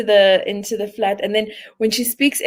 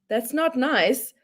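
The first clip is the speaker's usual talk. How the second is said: slow, softer mock-prim assistant voice; a prissy digital scold, drawn out; lightly voiced Siri telling you off; imitating Siri; 4 semitones higher, 6 dB quieter, slower than usual.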